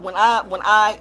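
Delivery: ordinary talk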